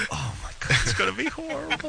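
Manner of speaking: high, dramatic dismay; mock horror. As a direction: gasp it out in mock dread. Host mimics horrified reaction.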